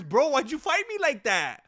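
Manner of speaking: high whiny mock plea; teasing the loser as if he were crying to Roundtree. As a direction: whimper like a sore loser. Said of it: "he's basically crying to him".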